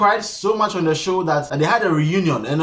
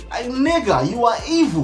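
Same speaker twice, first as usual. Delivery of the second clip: high, exasperated accusation; indignant call-out; 7 semitones higher than usual. Real, not acted. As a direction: accuse him in comic outrage. Calling out hypocrite.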